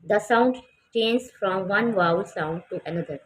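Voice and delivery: each word drawn out for the class; very slow reading. Shown as a demonstration. Teacher reading to the class, very slow. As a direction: read it out as if for dictation. Teacher reads the textbook line.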